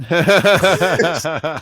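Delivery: loud, high laughter breaking the words; helpless laugh swallowing the sentence; cracking up. Real, not acted. Co-host cracking up at "hate her", laughing through words.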